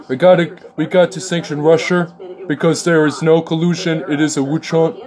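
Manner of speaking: mild mock Trump rant; Trump impression. Mocking Trump's Russia speech, lightly voiced. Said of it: "he can't pronounce Russia either".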